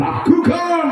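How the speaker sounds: sharp high shout; shouted call. Real, not acted. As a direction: call the kick like a commentator. Calling the penalty kick.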